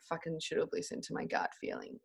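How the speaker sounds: hushed self-scolding whisper. Retold or imitated. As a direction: mutter it under your breath. Retold self-scolding, unvoiced.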